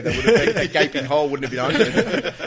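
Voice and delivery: laughing. Real, not acted. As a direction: laugh the words out. Still laughing at the joke.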